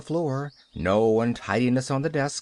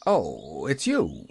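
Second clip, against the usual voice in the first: low, grudging recognition; not very gracious; 8 semitones lower, slower than usual. A narrator's reading. Man in bed seeing Pollyanna, not very gracious. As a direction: break off with a grudging, dropped greeting. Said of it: "not very graciously".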